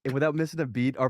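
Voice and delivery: ordinary talk, nothing marked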